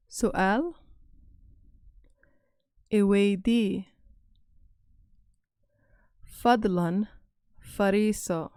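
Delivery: slow, loud model of each phrase; slow review. Shown as a demonstration. Teacher reviewing classroom phrases for learners to repeat. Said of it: "I'll go over them again".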